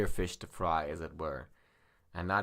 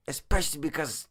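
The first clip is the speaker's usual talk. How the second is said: pained high voice; 8 semitones higher, 5 dB louder than usual. Acted. In pain, berating himself.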